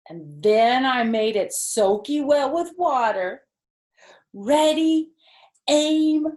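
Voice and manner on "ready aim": high, loud game call; louder and higher on fire; excited countdown. Acted. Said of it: "I said".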